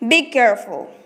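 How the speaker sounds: "Be careful" with the pitch dropping to the end; falling tone. Shown as a demonstration. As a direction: let "careful" fall away firmly. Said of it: "Fall or falling tone".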